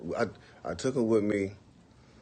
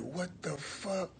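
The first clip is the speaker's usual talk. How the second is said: stunned disbelief; 10 semitones higher, 8 dB quieter, much slower than usual. Retold or imitated. Narrator hears stereo again.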